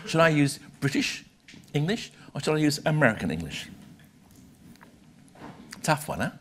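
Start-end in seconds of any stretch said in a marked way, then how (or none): none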